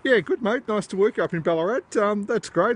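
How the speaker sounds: high, bright-eyed thrill at the contact; excited reply. Real, not acted. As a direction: sound over the moon to hear him. First satellite contact, replying.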